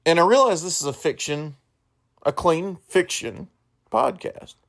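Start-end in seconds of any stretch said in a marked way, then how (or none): none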